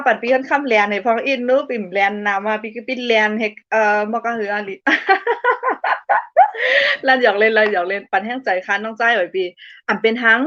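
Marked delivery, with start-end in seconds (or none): 4.8-7.0 high-pitched burst of laughter; high, rippling laugh; real laugh. Real, not acted. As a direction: burst out in a long, high giggle. Amused while chatting with viewers.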